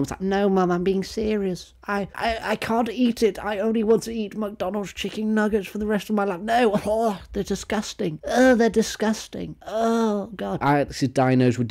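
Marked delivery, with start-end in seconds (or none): 0.2-6.4 put-on high whiny child begging mum; whiny kid. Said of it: "something you say as a kid to your mum". 6.4-10.6 high squeaky disgusted whine; high, slow mock disgust; whiny disgusted kid.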